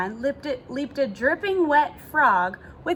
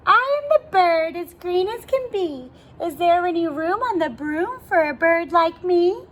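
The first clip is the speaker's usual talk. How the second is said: high, loud bird voice; raised, perky voice for a talking bird; little bird character; 5 semitones higher, 4 dB louder than usual. A narrator's reading. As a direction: ask in a high-pitched, eager storybook bird voice. Narrator voices the green bird asking.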